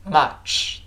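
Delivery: "much" with a relaxed "uh", mouth naturally open; correct model vowel. Shown as a demonstration. Teacher leads students repeating, "much" with a relaxed "uh". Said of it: "跟老师读起来".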